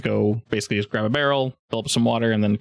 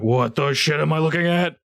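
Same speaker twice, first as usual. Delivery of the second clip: baffled ogre; 5 semitones higher than usual. Acted. Ogre chief baffled by ice.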